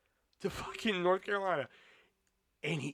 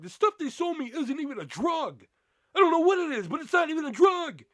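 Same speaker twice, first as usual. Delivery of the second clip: indignant rant; 11 semitones higher, 8 dB louder than usual. Retold or imitated. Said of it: "He was just like".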